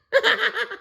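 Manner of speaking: very high laughing bird call; kookaburra cackle. A narrator's reading. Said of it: "offered Jacko".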